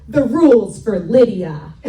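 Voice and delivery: loud, imitated child's announcement; emphatic declaration. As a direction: proclaim it as the punchline. Child's answer quoted as punchline.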